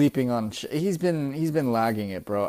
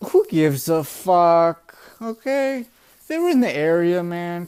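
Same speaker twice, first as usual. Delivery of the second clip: loud, high, drawn-out scoff; emphatic dismissal; 4 semitones higher, 5 dB louder, slower than usual. Real, not acted. Reviewer dismisses readers' complaints, loudly exasperated. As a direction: stretch every word to wave the complaint away.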